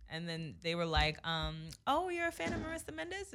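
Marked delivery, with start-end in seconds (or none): 1.9-3.3 high, eager fan voice; excited fan. Retold or imitated. Stranger at the car window gushing to mom, high and eager. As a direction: gush like a starstruck stranger.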